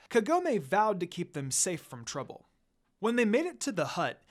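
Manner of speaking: usual speaking voice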